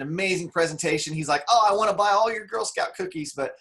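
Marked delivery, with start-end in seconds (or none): 1.5-3.3 high, loud burst of delight; eager excitement. Retold or imitated. Jim Rohn to the Girl Scout, eager and high. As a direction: gush at the girl like a thrilled buyer.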